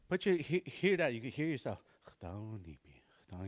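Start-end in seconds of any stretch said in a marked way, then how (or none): 2.1-3.5 "Chthonipi" said softly, lower and much quieter; quiet practice. Shown as a demonstration. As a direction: murmur "Chthonipi" under your breath. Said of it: "you can do it quietly".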